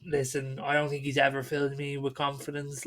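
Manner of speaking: ordinary talk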